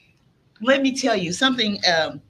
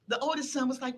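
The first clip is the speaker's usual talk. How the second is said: ordinary delivery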